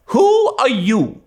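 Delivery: high, slow curt demand; abrupt old lady. A narrator's reading. Miss Barry to Anne, demanding bluntly. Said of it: "demanded Miss Josephine Barry without ceremony".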